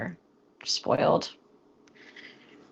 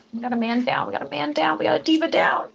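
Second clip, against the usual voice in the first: mock alarm; 7 semitones higher than usual. Streamer reports a fallen tater, mock alarm. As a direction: call it out like a hammed-up crisis report.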